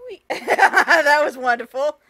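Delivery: loud laugh through words. Real, not acted. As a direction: laugh while saying it. Laughing at the game.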